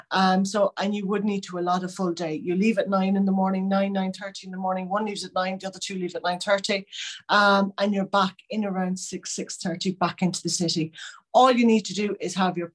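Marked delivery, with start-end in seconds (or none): none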